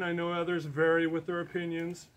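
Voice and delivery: usual speaking voice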